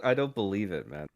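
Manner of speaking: ordinary talk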